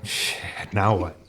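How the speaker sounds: unvoiced hushed curse, then a deflated question; dismayed mutter. Friend looks down at the smashed truck, hushed and dismayed. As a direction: mutter it looking at the wreck.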